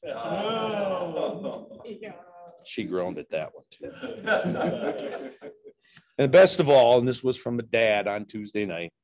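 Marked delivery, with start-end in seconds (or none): 0.1-1.8 groan at the pun. Real, not acted. Groan after own pun. 3.9-5.7 laughing at own joke. Real, not acted.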